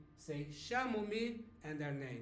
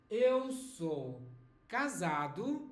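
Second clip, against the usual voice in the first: slow model phrase; 2 semitones higher, 4 dB louder, slower than usual. Shown as a demonstration. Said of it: "You may have to say".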